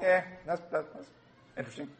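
unimpressed. Retold or imitated. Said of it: "and I was like".

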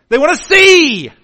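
shouted high and loud, "see" falling. Shown as a demonstration. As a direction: call out "see" loudly. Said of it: "repeated softer".

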